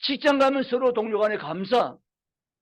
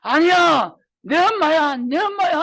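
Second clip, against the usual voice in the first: put-on high, loud sobbing protest of a small child; a seven-year-old bursting into tears, clinging to mom; 울음을 터뜨리며 외치는 아이; child crying out; 8 semitones higher, 5 dB louder, slower than usual. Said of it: "애가 울음이 터지면서".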